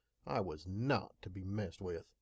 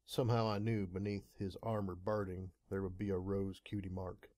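ordinary delivery

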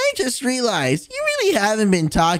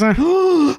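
loud delighted gasp; excited gasp; 5 semitones higher, 4 dB louder than usual. Real, not acted. Gasp at cookies.

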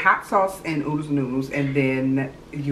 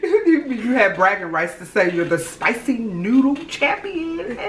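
laughing; 13 semitones higher than usual. Real, not acted. Laughing about the winner's prize.

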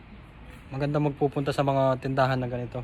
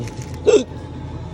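hiccup gasp; 12 semitones higher than usual. Real, not acted. Hiccup while shopping.

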